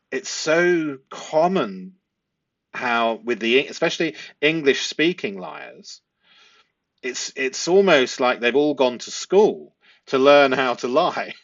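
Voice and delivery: ordinary delivery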